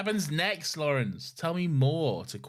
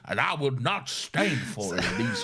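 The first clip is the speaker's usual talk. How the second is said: courtly Southern gentleman; 4 semitones higher than usual. Pretend. Showing the co-host the idea, affronted Southern gentleman. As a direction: protest grandly as a mock Southern gentleman. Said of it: "imagining just a courtly southern gentleman".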